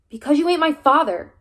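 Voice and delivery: loud, high angry snap; boy snapping at the coach who helped him; snapped. Acted. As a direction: lash out at him. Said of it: "I snapped".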